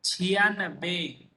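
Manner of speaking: छियानबे drawn out slowly, each syllable clear; teacher modelling छियानबे slowly; slow pronunciation demo. Shown as a demonstration. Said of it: "कैसे होता है?".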